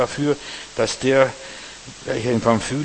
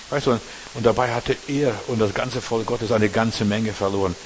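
usual speaking voice

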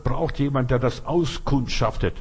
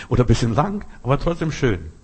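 normal talking voice

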